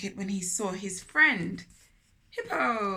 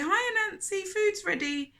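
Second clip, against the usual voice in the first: high bright calling voice; imagined phone call; 10 semitones higher than usual. Retold or imitated. Narrator imagines Hippo phoning Anansi, chirpy and high. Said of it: "couldn't call him and say".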